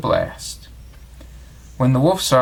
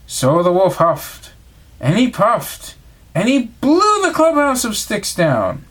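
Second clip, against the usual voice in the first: rising higher, slower, blowing the words; huffing and puffing; 8 semitones higher, 3 dB louder, slower than usual. A narrator's reading. Narrator acting out the wolf blowing down the sticks, rising and slow. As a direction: build the puffs up like a big breath out.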